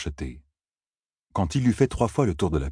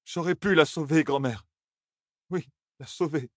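higher voice, fading softer into grief; tearful; 9 semitones higher, 3 dB quieter than usual. A narrator's reading. Grandson alone at the railing after grandmother left, tearful. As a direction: let it sink from protest to tearful murmur. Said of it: "des larmes aux yeux".

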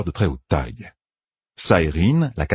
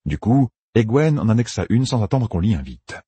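plain reading voice

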